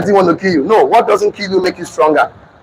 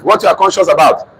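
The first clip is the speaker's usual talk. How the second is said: ordinary talk, nothing marked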